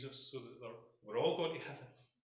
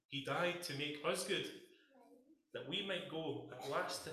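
hymn recitation; slower than usual. Acted. Recites hymn words in prayer.

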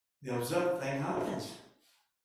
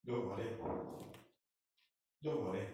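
calm reassurance; 5 semitones lower, 7 dB quieter than usual. Acted. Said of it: "Moses says".

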